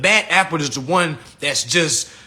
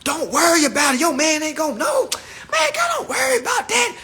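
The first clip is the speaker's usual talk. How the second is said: very high, shouty mock woman's voice; loud ratchet friend; 13 semitones higher than usual. Ratchet friend telling the girls not to worry, high and shrill. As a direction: screech it like the wild friend egging her on. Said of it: "tell the other girls".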